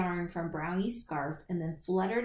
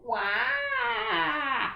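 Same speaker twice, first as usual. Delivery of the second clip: high, loud wailing wah; howling cry; 10 semitones higher, 4 dB louder than usual. Acted. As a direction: throw the head back and wail. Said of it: "threw his head back and howled".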